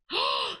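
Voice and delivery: a sharp real gasp of surprise; surprised gasp. Real, not acted. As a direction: gasp as the colour shocks you. Surprised by the yellow colour.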